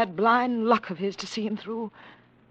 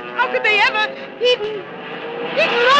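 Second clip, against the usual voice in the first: higher, louder panicked cry; panicked, much higher and louder; terrified cry; 13 semitones higher, 8 dB louder than usual. Acted. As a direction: scream his name in terror.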